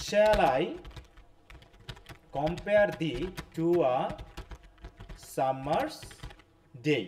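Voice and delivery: very slow, with long pauses between phrases; spaced-out, deliberate recitation; slow verse reading. Shown as a demonstration. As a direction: read the line out piece by piece. Teacher reads out the verse line.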